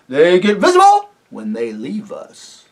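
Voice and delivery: high, loud, hearty rustic voice with a chuckle; jovial rustic chief, laughing as he talks; chief of the invisible folk. Acted. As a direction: boom it out like a jolly simple village chief. Chief voice explains.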